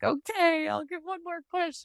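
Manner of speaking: high, softening mock surrender; mock reluctant giving-in. Mom reenacting agreeing to push, hammed-up giving in. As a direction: cave in with exaggerated reluctance. Giving in during retold labor.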